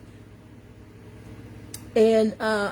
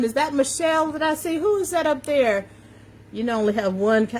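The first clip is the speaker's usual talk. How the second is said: ordinary talk, nothing marked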